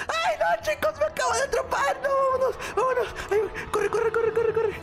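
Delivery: very high, frantic cries; panicked. Acted. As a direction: scream in mock panic. Caught again, fleeing. (pánico fingido)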